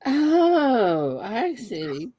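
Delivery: usual speaking voice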